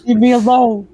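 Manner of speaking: loud, hammed-up outrage; mock indignant shout. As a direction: yell it in mock offense. Mock bathroom protest.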